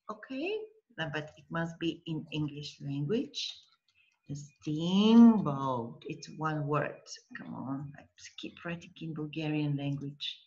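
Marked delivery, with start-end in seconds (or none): 4.3-6.0 "steamboat" stretched, long "steam"; slow dictation. Shown as a demonstration. Teacher rewrites word in English, slow dictation. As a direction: draw out "A steamboat" while writing it.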